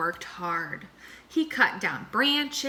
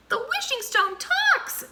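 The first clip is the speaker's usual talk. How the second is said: very high, astonished squeak; amazed gasp. A narrator's reading. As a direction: gasp in wonder at a talking stone. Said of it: "gasped Mouse".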